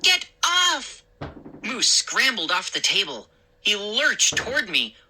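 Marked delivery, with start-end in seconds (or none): 0.0-1.0 panicked yell. A narrator's reading. Mindy panicking.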